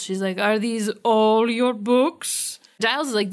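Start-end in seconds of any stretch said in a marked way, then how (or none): 0.4-2.6 slow, witchy British disdain; mock British accent. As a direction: draw it out in hammed-up British. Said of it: "are you doing a British accent".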